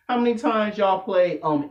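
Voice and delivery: normal talking voice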